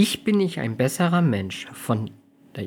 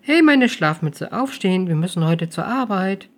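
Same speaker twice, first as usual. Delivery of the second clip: gentle wake-up call; 7 semitones higher, 4 dB louder than usual. Acted. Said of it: "weckte Amelie sanft auf".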